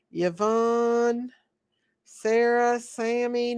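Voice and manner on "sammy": ordinary talk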